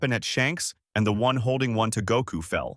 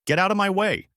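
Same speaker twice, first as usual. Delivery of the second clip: yelled; 10 semitones higher than usual. A narrator's reading. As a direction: shove past them, shouting. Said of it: "Goku yelled out".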